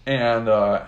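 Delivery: ordinary delivery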